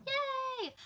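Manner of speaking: very high squeal of a cheer; excited cheer. Real, not acted. Celebrating the new Rebel release, squealing cheer.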